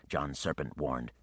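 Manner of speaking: plain reading voice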